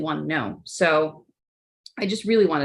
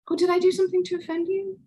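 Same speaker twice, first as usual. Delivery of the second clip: mock-sarcastic, pitched way up; 11 semitones higher, faster than usual. How coworkers heard her question, mock sarcastic. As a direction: sing it out snidely, not meaning it. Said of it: "I was sarcastically asking".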